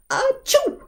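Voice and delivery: high, sharp achoo sneeze; fake sneeze. Shown as a demonstration. Teacher imitating a sneeze for the class. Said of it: "the sound of sneezing".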